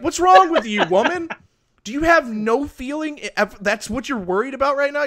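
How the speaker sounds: high, loud exasperated retelling; mock outrage. Man retelling scolding his wife for not crying, loud mock exasperation. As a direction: throw up your hands at her, half-joking. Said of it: "I'm like".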